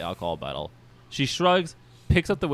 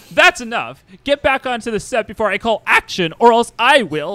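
higher, loud threat; angry tyrant director; 9 semitones higher, 8 dB louder than usual. Acted. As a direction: order them back like a bossy showman. Mojo threatens the cast.

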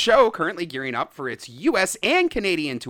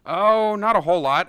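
usual speaking voice

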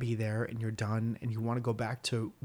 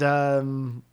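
normal talking voice

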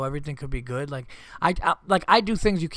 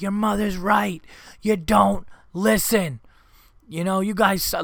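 slow, higher stern scolding; finally scolding; 6 semitones higher, 4 dB louder, slower than usual. Retold or imitated. Dad finally losing patience with kids, slow stern scolding. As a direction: drag out each word to make it stick.

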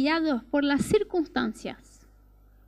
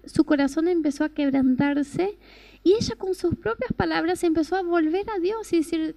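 ordinary talk

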